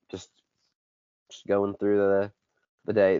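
ordinary delivery

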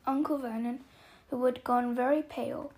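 ordinary delivery, nothing marked

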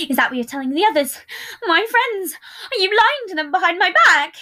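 loud, high-pitched tearful accusation; crying out. A narrator's reading. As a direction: cry out, hurt and betrayed. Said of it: "he cried out as tears formed".

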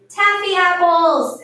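high, loud, drawn-out cheer; excited group shout. A narrator's reading. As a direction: shout it with children's delight. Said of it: "everybody shouted".

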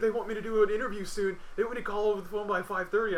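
imitated fretting. Friend explains the phone interview deadline, imitated fretting. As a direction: imitate a flustered friend on a call. Retells friend's anxious call.

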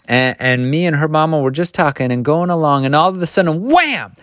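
climbing in pitch to a startling "wham"; animated storytelling. A narrator's reading. Missus Turpin recounting the attack, animated. Acting out the sudden book attack.

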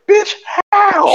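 very high mock-panicked squeal; incredulous yelp. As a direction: squeal in disbelief as the bitten victim. Acted victim told be still.

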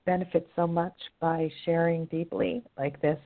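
ordinary delivery

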